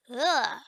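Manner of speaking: loud, disgusted "ugh"; disgusted groan. Acted. Visitor finding old food in the bedroom, disgusted groan.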